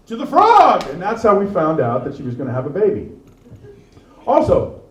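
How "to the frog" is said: high rallying call; mock heroic cry. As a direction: charge like a hero into battle. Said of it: "So I was like".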